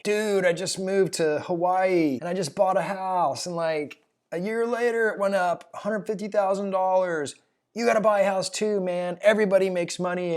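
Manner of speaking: high, breezy California surfer accent, mocking; hammed-up surfer dude accent. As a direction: play the laid-back surfer bro with a surfer accent. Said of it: "surfer accent thing".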